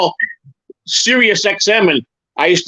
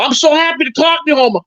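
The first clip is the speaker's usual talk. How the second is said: high, eager fake caller voice; a high put-on voice for a prank call; 9 semitones higher than usual. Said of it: "I go".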